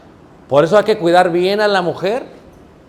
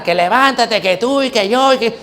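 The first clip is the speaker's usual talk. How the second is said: raised-pitch imitation of bickering spouses; mock quarrel; 5 semitones higher than usual. Preacher imitating husband and wife fighting, mock quarrel. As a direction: act out a couple squabbling. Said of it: "Y luego se agarran".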